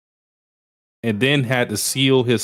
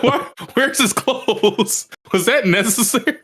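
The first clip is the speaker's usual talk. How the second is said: high, laughing incredulous question; laughing disbelief; 11 semitones higher than usual. Retold or imitated. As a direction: ask it while cracking up. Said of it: "I was like".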